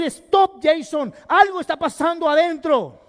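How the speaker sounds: sharp loud stop, then urging; a loud inner stop command; self-rebuke. Retold or imitated. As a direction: call yourself to halt. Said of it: "tengo que decir".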